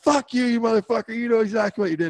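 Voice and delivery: high, strained retold cursing; furious accusation. Rider curses the judge to his face, enraged. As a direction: accuse him like he's caught red-handed.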